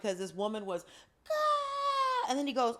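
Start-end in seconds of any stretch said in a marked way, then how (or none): 1.3-2.3 high, held imitation scream; mock banshee scream. Angel chasing the falling woman, mock screaming. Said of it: "he's screaming like a banshee".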